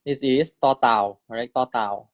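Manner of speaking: ordinary talk, nothing marked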